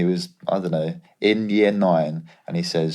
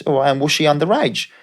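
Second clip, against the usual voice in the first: put-on higher, brisk Australian accent asking a blunt question; Australian agent voice; 7 semitones higher than usual. Australian agent grilling the player on the phone, higher Aussie accent. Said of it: "he's Australian".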